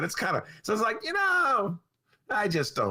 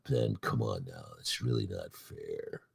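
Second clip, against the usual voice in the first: lower, softer mock whining; whiny protest; 8 dB quieter than usual. Host imitating politicians pleading with Ben, mock whining. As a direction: whimper a complaint at Ben. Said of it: "I could just hear them".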